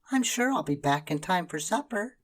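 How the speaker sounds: put-on high girl's voice; little girl. A narrator's reading. Karen assuring she'll be home for supper. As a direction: reassure lightly.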